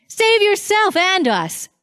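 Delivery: high, jeering taunt; mocking insult. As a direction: throw it at him as a sneer. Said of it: "hurled insults at him".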